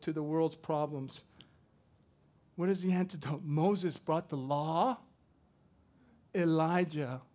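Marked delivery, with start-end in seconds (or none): 3.4-7.2 slow emphasis. Shown as a demonstration. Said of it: "slow emphatic statement".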